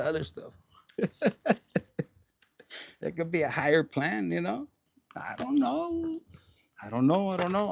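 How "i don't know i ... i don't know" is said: teasing singsong. Pretend. Teasing viewers about the hidden meaning, high and coy.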